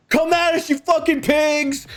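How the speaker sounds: high-pitched mock battle cry; defiant taunt. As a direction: act out a protester daring the enemy to attack. Activists taunt from cover.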